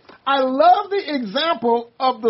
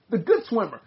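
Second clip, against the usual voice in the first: ordinary talk, nothing marked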